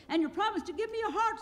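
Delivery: Tin Woodman. A narrator's reading. The Tin Woodman asking Oz for his heart, high and quick. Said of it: "said the Tin Woodman".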